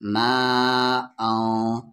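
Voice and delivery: मा held three to four beats before औ; long madd stretch. Shown as a demonstration. Teacher showing madd before hamza, मा drawn out long. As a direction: stretch the vowel of मा long, then औ. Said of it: "तीन से चार अलिफ के बराबर".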